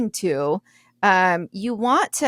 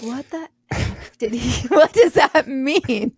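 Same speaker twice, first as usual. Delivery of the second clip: slow, baffled mock voice breaking into a laugh; puzzled, laughing through it; confused listener; 5 semitones higher, slower than usual. Imitating someone confused by the word enigmatic, laughing.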